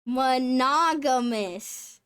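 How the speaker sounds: monogamous slowly as mono-GAM-ous with nah; deliberate correct monogamous; slow repeat. Shown as a demonstration. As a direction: copy the AI carefully. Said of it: "AI says Monogamous".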